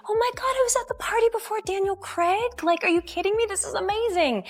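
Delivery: high, gushing fan excitement; starstruck. Retold or imitated. Party guest spotting Daniel Craig walk in, starstruck.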